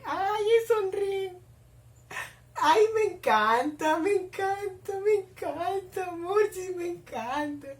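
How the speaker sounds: high, slow, drawn-out gushing; melting fangirl squeal, long vowels; swooning delight. Real, not acted. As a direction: stretch each word in a high coo. Swooning as the couple smile.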